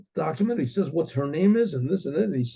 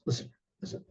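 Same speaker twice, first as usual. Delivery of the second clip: whispered, leaning in; father whispering to the groom; confidential whisper; 12 dB quieter, faster than usual. Acted. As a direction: draw him close to bribe him. Father bribing groom quietly.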